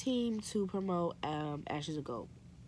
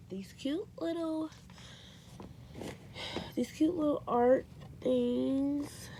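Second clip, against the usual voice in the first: gushing; 7 semitones higher, slower than usual. Real, not acted. Gushing over cute art.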